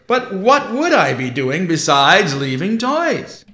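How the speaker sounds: booming Father Christmas, amused and surprised; jolly Santa Claus. Acted.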